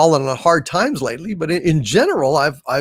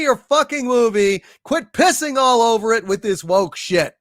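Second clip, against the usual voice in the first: angry rant; 7 semitones higher than usual. Real, not acted. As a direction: give it to them, fed up. Ranting at Disney over Indiana Jones.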